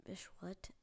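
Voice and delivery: hushed, voiceless aside; whispered reaction. Retold or imitated. Listener doubting it's fifth grade, mock whisper.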